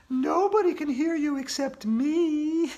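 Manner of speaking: put-on eerie ghost girl teasing. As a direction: taunt him like a spooky ghost. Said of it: "said the ghost of Andrea".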